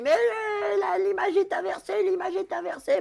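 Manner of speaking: high panicked mock shout; retold panic. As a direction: squeal it like a startled host. Retelling his panicked complaint.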